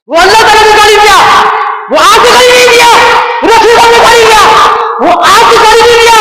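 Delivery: high, ringing accusation; full-force preaching. Real, not acted. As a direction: pound out each sentence high and insistent. Preacher hammers repeated accusation. (ऊँचे सुर में जोशीला आरोप)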